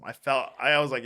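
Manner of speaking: ordinary talk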